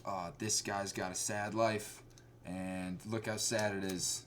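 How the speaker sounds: soft, slow, mopey parody; mock the movie's self-pity slowly; mock Oscar bait. Reviewer parodying an Oscar bait film, soft and slow. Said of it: "It was just like".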